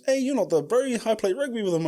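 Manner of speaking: voicing the other cop high. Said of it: "and he goes".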